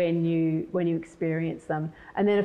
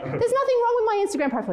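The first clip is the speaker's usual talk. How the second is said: fast, very high mock indignation; indignant defence; faster than usual. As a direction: protest in a rushed high pitch. Acting her indignant defence to son.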